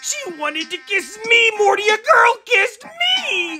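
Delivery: overjoyed. Acted. Overjoyed first kiss shouting.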